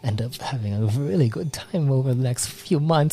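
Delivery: eager chatter, climbing to a high squeal; excited reassuring character. Acted. Voices cheerful character reassuring patient.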